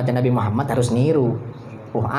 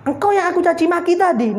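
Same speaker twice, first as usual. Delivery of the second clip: put-on shocked old woman; 14 semitones higher than usual. Grandmother realizes her mistake.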